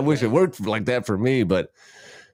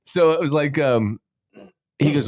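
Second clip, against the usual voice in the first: normal talking voice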